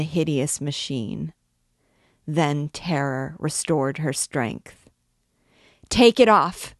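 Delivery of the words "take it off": loud, high, panicked plea; desperate shriek of fear; frantic cry. A narrator's reading. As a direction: scream it in terror to make them stop. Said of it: "she cried frantically".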